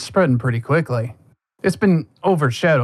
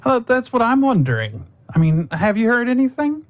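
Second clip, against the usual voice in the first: put-on high, eager child's voice; a curious little girl asking; 9 semitones higher than usual.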